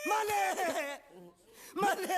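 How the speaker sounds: high, hammed-up wail; wailing plea. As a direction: cry out pleadingly for your master. Servant wails for his master. (मालिक को पुकारता नाटकीय विलाप)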